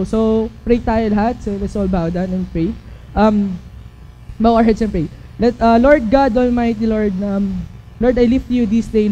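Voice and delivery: soft, low earnest prayer. Acted. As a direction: pray quietly before the youth. Leads an opening prayer. (mahinang taimtim na panalangin)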